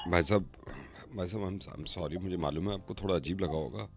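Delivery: low, soft and apologetic; sheepish apology. Real, not acted. Caller apologizing to the stranger he shouted at, sheepish. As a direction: drop right down to apologize.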